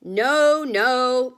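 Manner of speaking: yelling back. A narrator's reading. Wonka yelling back at the grandparents, loud refusal. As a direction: shout it back over the noise. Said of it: "Mister Wonka yelled back".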